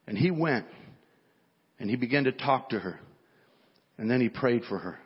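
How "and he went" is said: ordinary talk, nothing marked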